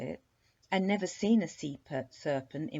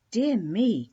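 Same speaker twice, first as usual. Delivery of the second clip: cried out; 5 semitones higher, 9 dB louder than usual. A narrator's reading. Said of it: "cried Princess Venetia Ella".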